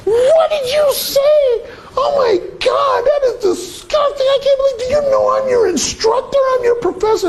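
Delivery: high, shocked, indignant screaming; outraged shriek. Retold or imitated. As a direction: play the appalled instructor shrieking at him. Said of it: "She flips the fuck out".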